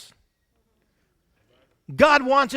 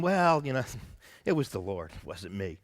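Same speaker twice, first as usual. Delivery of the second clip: much softer, lower mock-humble murmur; 12 semitones lower, 6 dB quieter than usual. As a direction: imitate false modesty, drop to a hush. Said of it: "I used to have this thing going".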